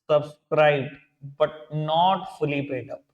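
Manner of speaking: very slow, spaced-out words; measured pace for note-takers; slow dictation. Real, not acted. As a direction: dictate word by word, stretching them. Teacher dictates the second heading.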